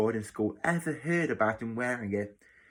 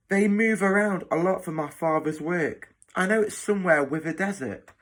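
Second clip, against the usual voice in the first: put-on higher-pitched boy's voice; schoolboy Tom; 7 semitones higher, 4 dB louder than usual. New boy explaining his parents' absence, higher-pitched boy. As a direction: explain in a high, youthful voice.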